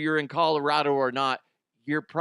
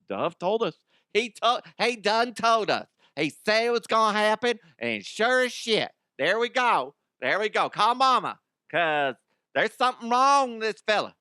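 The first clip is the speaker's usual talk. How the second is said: Southern voice; 8 semitones higher than usual. Acted. Listener recalling the warning, in a Southern accent. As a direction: play a Southern listener who saw it coming. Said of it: "I went down that Southern voice route".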